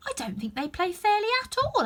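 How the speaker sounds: high, whiny complaint; complaining tone. Acted. Alice complaining to the Cheshire Cat, high and whiny. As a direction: grumble to the cat. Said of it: "in a rather complaining tone".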